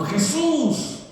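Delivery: high mock child's cry of the name; lightly voiced little girl calling out; child's shout. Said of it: "dio un grito". (grito agudo de niña imitado)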